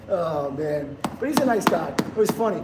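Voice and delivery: normal talking voice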